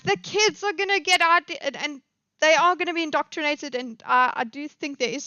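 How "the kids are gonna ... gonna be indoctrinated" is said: higher-pitched, louder mimicry of fretful critics; mock alarm. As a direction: imitate someone sounding the alarm. Imitating worried critics of woke media.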